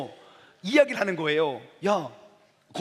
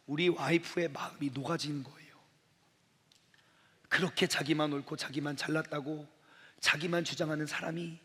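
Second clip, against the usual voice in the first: ordinary talk, nothing marked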